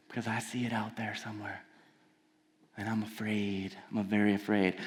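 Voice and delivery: quiet admission of fear; hushed confession. Real, not acted. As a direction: drop to a near hush.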